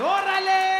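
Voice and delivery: high shouted Mexican exclamation; Mexican yell. Retold or imitated. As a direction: yell it like a Mexican shooting off a ramp. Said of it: "It's like".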